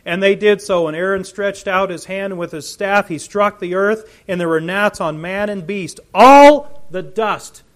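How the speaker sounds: loud, high proclaiming of the scripture; preacher thundering out the plague of gnats; full-force preaching. Acted. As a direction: preach it to the back row.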